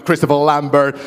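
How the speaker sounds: ordinary talk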